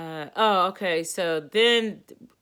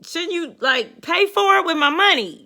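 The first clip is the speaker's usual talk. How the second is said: pointed look; 10 semitones higher, 7 dB louder than usual. Retold or imitated. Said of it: "I'm looking at her like".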